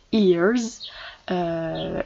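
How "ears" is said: "ears" said alone as a clear model word; "ears" with a long ee; simple model pronunciation. Shown as a demonstration. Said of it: "sa prononciation, il est simple".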